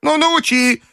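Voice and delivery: put-on very high old-lady character voice; grandmother's voice. A narrator's reading. Grandmother asking the Frenchman to teach her, high voice. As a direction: ask as a demanding old lady, pitched high. Grandmother asks de Grieux for advice.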